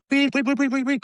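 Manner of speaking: high, piercing, repeated beeps; mock whistle beeps. Teammates blowing the whistle at a brawl, high mock beeping. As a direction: squeal like a whistle. Players mimic a referee whistle.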